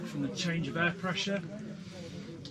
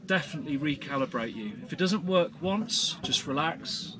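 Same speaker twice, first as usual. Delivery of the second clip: guided breathing; 3 semitones lower than usual. Acted. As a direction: talk them gently into calm. Leads a breathing exercise.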